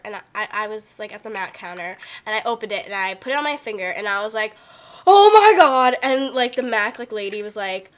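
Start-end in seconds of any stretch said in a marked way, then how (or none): 5.1-6.0 loud, high mock scream of delight; retold ecstatic outburst. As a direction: re-enact losing it over a makeup find. Retold freak-out at makeup counter.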